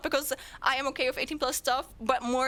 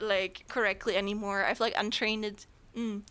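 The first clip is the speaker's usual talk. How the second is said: ordinary delivery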